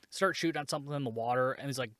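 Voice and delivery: ordinary talk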